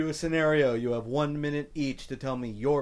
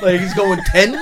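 laughing; 14 semitones higher, 7 dB louder than usual. Real, not acted. Mocking jingle's inflection, laughing.

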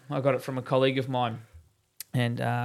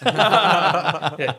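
laughing; 4 semitones higher, 8 dB louder than usual. Real, not acted. Laughing at shirt price joke.

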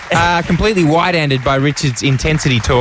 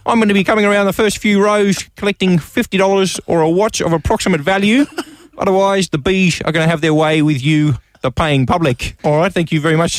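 Bob Franklin; 2 semitones higher than usual. Acted. Actor addressing his trapped theatre audience, half-hearted celebrity impression. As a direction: play a deadpan stage showman with slurred esses. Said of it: "attempting a Bob Franklin impression".

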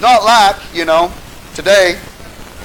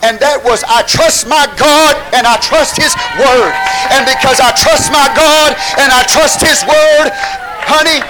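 raised, high-pitched pulpit proclamation; full-force preaching; 5 semitones higher, 4 dB louder than usual. Real, not acted. Preacher proclaiming the woman's trust in God, fervent preaching. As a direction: drive it out loud and high to the congregation.

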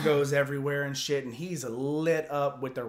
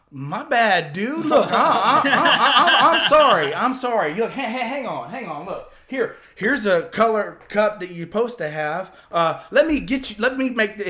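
high, loud, stammering backpedal; panicked apologizing; 9 semitones higher, 14 dB louder than usual. Retold or imitated. Truck owner realizing whose buddy he yelled at, panicked apologizing. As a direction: trip over yourself apologizing, hammed up.

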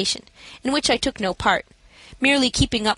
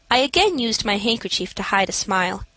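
ordinary delivery, nothing marked

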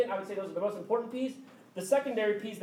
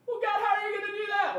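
doubting Moses; 13 semitones higher, faster than usual. Acted. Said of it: "Moses questioning God".

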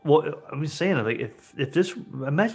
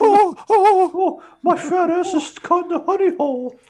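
high, put-on bear voice in trouble; silly high cartoon bear; Winnie the Pooh; 5 dB louder than usual. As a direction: squeal it like a cartoon bear stuck fast. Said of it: "it's all Winnie the Pooh".

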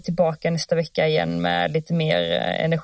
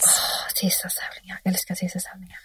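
whisper; 8 dB quieter than usual. Acted. Dreamy whisper over race nights.

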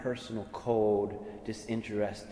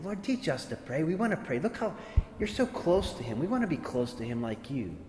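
pitched higher, eager disciples begging; eager pleading; 5 semitones higher than usual. Retold or imitated. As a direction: plead like keen disciples wanting to learn. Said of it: "And they're like".

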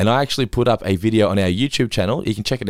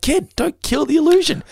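much higher, strained exasperated appeal; mock dismayed plea; 3 dB louder than usual. Costumed dad begging his son to play along, exasperated. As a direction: pitch it right up, pleading with the kid.

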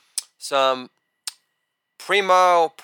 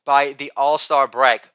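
normal talking voice

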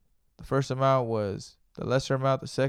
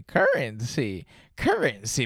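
cracking voice, drawn out; slower than usual. Real, not acted. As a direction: voice cracking on currency. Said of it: "my voice cracks from time to".

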